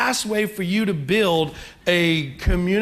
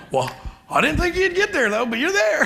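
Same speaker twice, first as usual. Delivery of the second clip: laughing dad quote; 9 semitones higher than usual. Retold or imitated.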